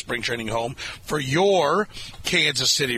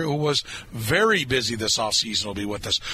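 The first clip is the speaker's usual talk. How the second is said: normal talking voice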